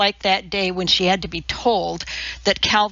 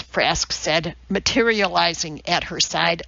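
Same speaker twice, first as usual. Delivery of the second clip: plain reading voice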